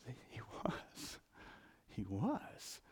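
breathy hushed aside; whisper. Real, not acted. Preacher whispering to the congregation that Jesus truly was king. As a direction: whisper it like a secret.

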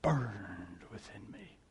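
put-on old missionary answering the pastor in a hush; hushed retold answer. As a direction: breathe it out quietly, in awe. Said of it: "He goes".

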